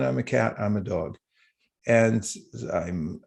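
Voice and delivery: usual speaking voice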